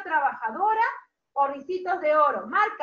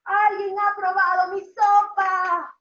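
loud, higher chanting complaint; annoyed Mama Bear; 4 semitones higher, 6 dB louder than usual. Acted. Teacher voices mamá osa finding her soup tasted, loud and annoyed. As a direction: call it out indignantly, nearly singing.

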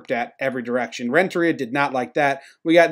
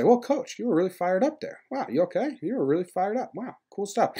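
high, soft little voices; mock admiring friends; 5 semitones higher, 8 dB quieter than usual. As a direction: imitate awed pals fussing over the coach. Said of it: "All his friends say".

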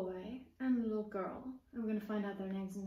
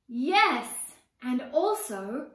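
eager chime-in; 9 dB louder, much slower than usual. Acted. Sister chimes in.

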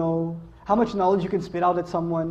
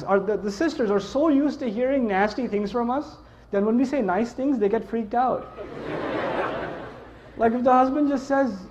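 ordinary talk, nothing marked

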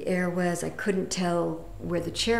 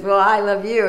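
lightly voiced garage guy, louder; 3 semitones higher, 9 dB louder than usual. Retells the garage guy's reply.

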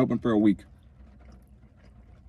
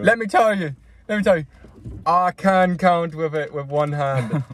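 bragging; 5 semitones higher than usual. Acted. Bragging about size.

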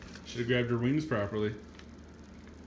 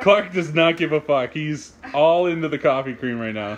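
loud amused; 7 dB louder than usual. Real, not acted. Laughing at friend's reaction.

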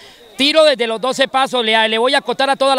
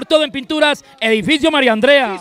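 ordinary delivery

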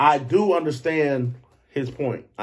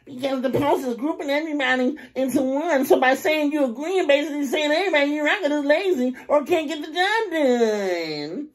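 mocking the other guy; 12 semitones higher than usual. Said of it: "He say".

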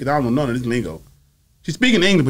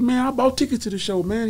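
much higher, eager fan voice; excited fan; 12 semitones higher than usual. Retold or imitated. Telling the promoter he bought tickets, excited. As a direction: gush about having tickets. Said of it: "I said".

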